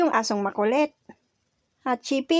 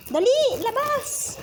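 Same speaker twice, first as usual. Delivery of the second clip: high urging call; 13 semitones higher, slower than usual. Real, not acted. Urging the dog outside.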